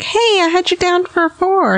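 high, bright mock-therapist voice; high mock therapist voice, reading out her text; imitating therapist. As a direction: chirp it like a cheery text. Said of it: "my therapist texted me and was like".

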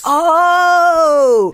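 loud, high "Oh!"; surprised shout. Real, not acted. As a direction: gasp it out in delight. Reacting to the title pitch.

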